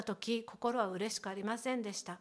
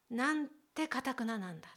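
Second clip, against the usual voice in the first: ordinary talk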